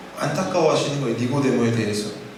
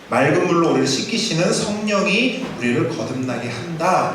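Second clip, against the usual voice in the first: usual speaking voice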